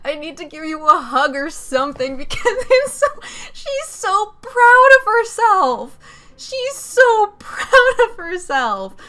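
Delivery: high, loud, slow and laughing; laughing squeal. Real, not acted. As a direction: gush through laughter about the character. Overwhelmed by cute character.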